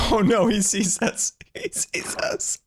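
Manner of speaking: high laughing oh no; laughing into a whisper; laughing panic. Real, not acted. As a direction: squeal and laugh as you're spotted. Caught by the troll, laughing.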